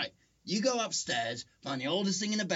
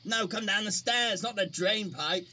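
shouting up; 6 semitones higher, 3 dB louder than usual. Acted. Exasperated shouting at Carl.

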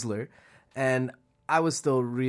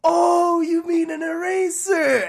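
much higher and louder, a laughing "oh" of realization; mock realizing teacher; 6 dB louder, slower than usual. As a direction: play the teacher finally getting it, squealing. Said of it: "And then she's like".

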